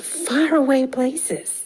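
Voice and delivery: usual speaking voice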